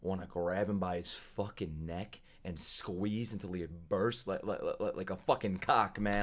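low, quiet, simmering anger; seething threat. Real, not acted. Viewer wanting to grab the villain's neck, quietly seething. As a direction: hold the rage down, low and soft.

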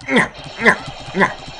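taunting nyah. Acted. Taunting the enemy in game.

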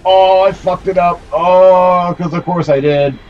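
a gamer's high cry after dying; real anguished wail. Real, not acted. Dies in the boss fight.

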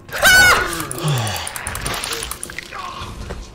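sudden high-pitched yelp; real high shriek trailing off; startled shriek. Real, not acted. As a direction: cry out at a jump scare. Startled by attack in game.

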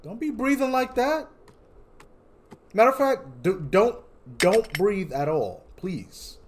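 high, loud nervous plea; scared pleading. Real, not acted. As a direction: beg the monster not to breathe. Begging the game to stop.

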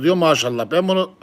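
normal talking voice

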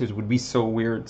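normal talking voice

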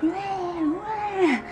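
high mock "wah wah" baby crying; imitated baby cry. Copying the baby nephew's new cry, high. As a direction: squeak out a baby's cry. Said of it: "he'll be like".

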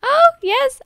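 high, loud cry of joy; delighted. Real, not acted. Player thrilled by her Sim, delighted cry. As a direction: burst out in triumph.

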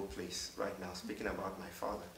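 holding back grief, barely above a murmur; voice starting to shake. Real, not acted. Pastor tells the congregation of his father, real soft grief. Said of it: "my voice starts to shake".

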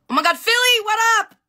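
high, loud happy greeting; excited shout-out. Real, not acted. Greeting a viewer who joined the live, excited high shout-out. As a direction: call out to a friend across the room.

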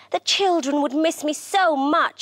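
a touch of regret; wistful sigh. A narrator's reading. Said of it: "she sighed".

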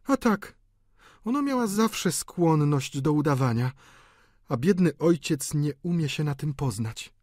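high female character voice; knowing sister. A narrator's reading. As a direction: confide disapproval of a relative. Delphine judges her sister.

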